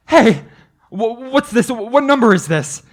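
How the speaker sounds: sudden loud cry, then high stammered questions; a man shouting down the line in panic; frantic shout. Acted.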